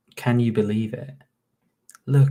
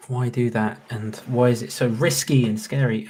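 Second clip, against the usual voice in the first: usual speaking voice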